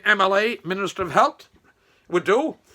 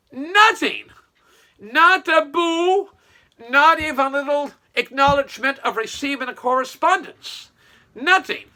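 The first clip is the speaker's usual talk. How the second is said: indignant; 7 semitones higher, 4 dB louder than usual. Real, not acted. Outraged that the letter to the MLA got no reply, high and loud. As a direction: hammer home that nothing came.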